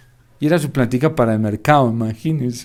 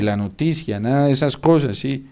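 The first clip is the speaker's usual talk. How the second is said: normal talking voice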